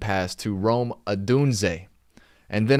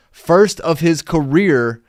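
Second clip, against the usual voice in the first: louder, higher burst of joy; excited cheer; 6 semitones higher, 8 dB louder, slower than usual. Real, not acted. Fan cheering the rookie's first career touchdown, excited. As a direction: hail the rookie's big moment.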